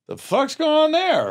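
surprised. Retold or imitated. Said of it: "I go".

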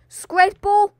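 high, loud startled question; a sudden, alarmed squeak; shrill surprise. Real, not acted. Startled by a squid.

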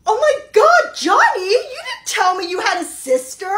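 high, loud, over-the-top gasp of flattery; hammed-up fake amazement at meeting the parent; gushing compliment. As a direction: gush like you're dazzled by the mother. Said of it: "For example".

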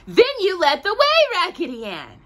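high, loud little doll voice; French dolly's cry. A narrator's reading. French dolly urging Raggedy Ann to lead, high and loud. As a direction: cheer on the leader. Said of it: "cried the French dolly".

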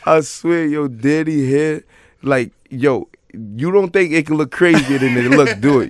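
loud, high, laughing storytelling; cracking up. Real, not acted. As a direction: barely get the words out for laughing. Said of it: "I'm laughing already".

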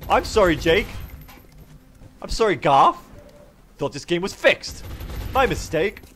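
pointed, high-pitched fake contrition; mock apology. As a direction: apologise with heavy mock surprise. Repeated mock apology to Jake.

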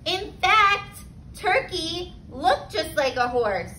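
ordinary delivery, nothing marked

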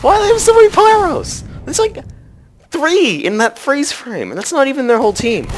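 can't believe how many there are; exasperated disbelief. Real, not acted. Sniper swamped by pyros, high exasperated outburst.

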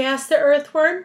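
plain reading voice

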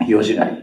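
"日吉狩り" said with "hi" where standard has "shi", as hiyoshigari; downtown Tokyo "shi" as "hi". Retold or imitated. Lecturer quoting cousin's trip plan, "日吉狩り" said as hiyoshigari with "hi" for "shi". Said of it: "それを言うなら潮干狩りじゃないの".

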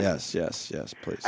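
quiet, fading eager agreement; soft eager yes. Acted. Host softly and eagerly agreeing with the caller. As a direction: let it fade to a near whisper.

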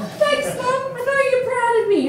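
ordinary talk, nothing marked

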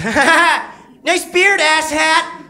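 high, jeering laugh and yell; mocking heckler. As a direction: mimic the bum heckling from the bench. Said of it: "This is what he yelled".

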